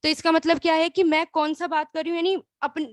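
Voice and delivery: ordinary talk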